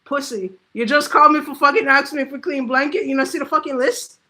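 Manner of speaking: mock outburst at a caller, kept in his head; inner rant. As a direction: vent the frustration he keeps inside. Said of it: "in my head, I'm like".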